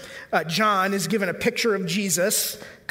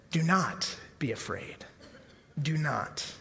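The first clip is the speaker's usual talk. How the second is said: soft reassurance; 5 semitones lower, 8 dB quieter, slower than usual. Acted. Jesus's comfort repeated softly.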